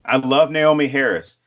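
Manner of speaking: usual speaking voice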